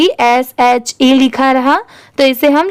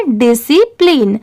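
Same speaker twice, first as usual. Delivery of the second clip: syllable by syllable. Shown as a demonstration. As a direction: stretch discipline out part by part. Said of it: "इन तीनों को मिला देंगे".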